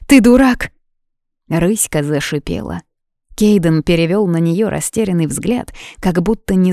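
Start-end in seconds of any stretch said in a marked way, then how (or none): 0.1-0.7 loud, high-pitched insult; angry outburst. A narrator's reading. Юна lashes out at Кейден, angry outburst. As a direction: burst out in exasperation.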